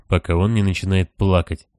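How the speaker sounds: ordinary delivery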